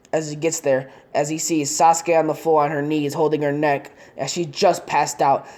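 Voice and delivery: plain reading voice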